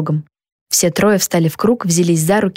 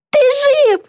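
high, overjoyed cry; tearful exclamation. A narrator's reading. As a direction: exclaim with high-pitched relief. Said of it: "воскликнула Битти, громко хлюпая носом". (радостно, высоким голосом, сквозь слёзы)

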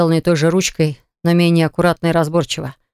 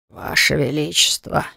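put-on old man voice; 5 dB quieter, slower than usual. Old oracle greets the king.

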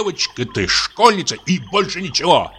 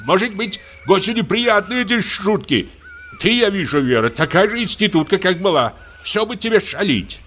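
ordinary delivery

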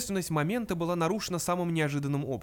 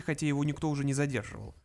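usual speaking voice

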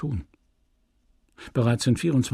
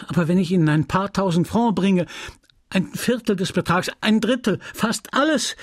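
high, climbing step by step; increasingly frantic woman's offer; desperate pleading; 8 semitones higher than usual. A narrator's reading. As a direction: bargain with rising urgency. Emma bargains desperately.

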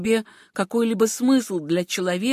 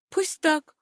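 tired; 7 semitones higher than usual. A narrator's reading. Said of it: "устало сказала Сюзанна".

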